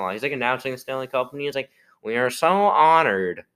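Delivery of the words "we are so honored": annoying mock announcer voice, higher and drawn out; hammed-up, loud, stretched ceremonial announcement; Gary Bettman. Podcaster mocking the league boss announcing the Cup, annoying voice. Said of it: "his voice is really annoying".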